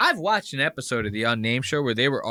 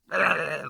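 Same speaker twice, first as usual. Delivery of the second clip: higher imitated bear growl; answering bear growl; 5 semitones higher than usual. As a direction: growl back like the rival bear. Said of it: "the other bear'd be going".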